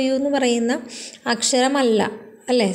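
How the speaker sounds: normal talking voice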